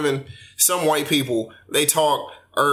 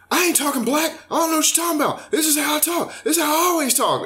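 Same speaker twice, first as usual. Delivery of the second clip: high-pitched mock indignant protest; imitating her, mad; 13 semitones higher than usual. Retelling her angry denial about how she talks, mock outrage. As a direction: mimic an offended woman, voice pitched way up. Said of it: "she got mad".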